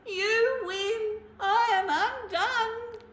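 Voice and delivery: loud, high, slow wailing; blubbering. Acted. Bear wails his defeat to the mouse. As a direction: sob out the defeat. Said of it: "he blubbered".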